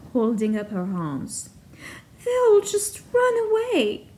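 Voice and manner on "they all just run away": high wailing cry, much higher; cried out. Acted. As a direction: wail it out in despair. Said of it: "Cleena cried".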